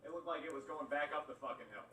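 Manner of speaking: ordinary talk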